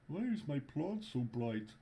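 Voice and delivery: puzzled Nordic lilt; high Scandinavian accent. Pretend. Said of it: "that's why I'm doing the Scandinavian accent".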